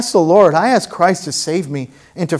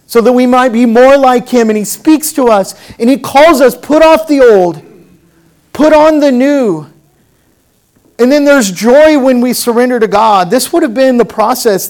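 raised, louder sermon voice; impassioned preaching; 8 semitones higher, 6 dB louder than usual. Real, not acted. Preacher to congregation on the joy of surrendering, impassioned preaching. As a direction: preach it out with rising pitch and force.

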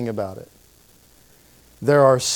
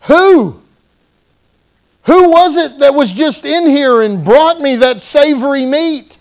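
alarmed cry; 11 semitones higher, 8 dB louder than usual. Retold or imitated. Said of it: "And he said".

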